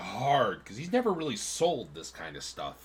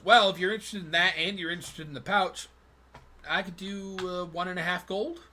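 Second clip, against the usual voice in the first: tanner offering a price; 6 semitones higher than usual. Acted. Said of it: "he goes".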